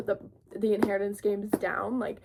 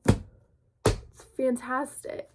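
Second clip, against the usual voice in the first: drawn-out gush; 3 semitones higher, much slower than usual. Real, not acted. Drawn-out gushing.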